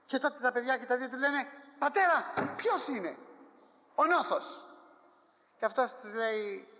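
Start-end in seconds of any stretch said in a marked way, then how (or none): 1.8-4.6 high, pressing plea to the father; sons' anxious question, raised high; urgent questioning sons. Acted. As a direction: ask it urgently, voice pitched higher. Sons ask dying father.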